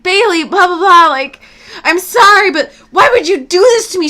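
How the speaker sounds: high, loud mock pleading; imitated drunk crying. Drunk boyfriend pleading with Bailey, imitated loud crying. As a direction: whine it out like a weepy drunk. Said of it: "stammering, all drunk, crying".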